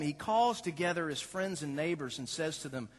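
ordinary delivery

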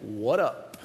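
low, soft, laid-back drop-in; deadpan, understated hello; casual greeting. Acted. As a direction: toss it off low and cool. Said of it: "and goes".

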